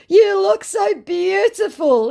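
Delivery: high, slow, syrupy put-on voice; mock scammer voice. Woman reading the scammer's message aloud, in a high mock voice. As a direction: ham up the creepy flattery.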